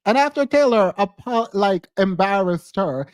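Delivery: ordinary delivery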